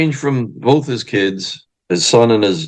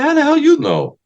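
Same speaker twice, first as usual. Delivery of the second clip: much higher incredulous squeal; astonished. Retold or imitated. Husband amazed his wife knows Freddie, in a high astonished voice. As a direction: disbelief that she knows the name.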